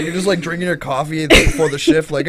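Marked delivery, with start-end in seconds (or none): none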